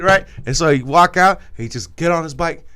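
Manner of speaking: ordinary talk, nothing marked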